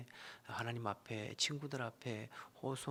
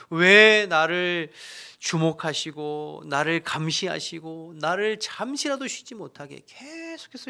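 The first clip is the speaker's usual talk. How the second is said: loud, slow, drawn-out cry to God; a slow, loud wail of pain; anguished cry; 4 semitones higher, 13 dB louder than usual. Acted. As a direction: cry out in despair, stretching each word. Voicing Job crying out to God.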